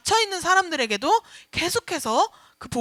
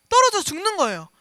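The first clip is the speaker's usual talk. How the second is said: normal talking voice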